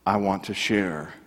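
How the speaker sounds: low, soft, heartfelt answer with pauses; quietly sincere. Retold or imitated. Former professor answers reporter why he became pastor, quietly sincere. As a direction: let it sink almost to a hush, humbly retold.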